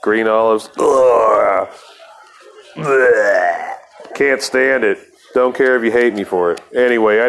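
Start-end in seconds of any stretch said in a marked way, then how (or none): 0.8-3.7 drawn-out "ugh", then "blech"; exaggerated groans of revulsion; disgusted retching noises. Real, not acted. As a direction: gag at the very thought. Disgust at green olives.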